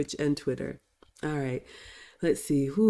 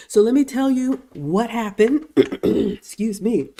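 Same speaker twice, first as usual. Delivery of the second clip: raspy; 6 semitones higher, 7 dB louder than usual. Real, not acted. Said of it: "I'm a little raspy".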